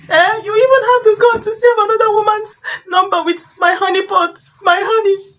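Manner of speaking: hurt accusations climbing into a high wail; outraged wife. Acted. Wife confronting her cheating husband, outraged and rising.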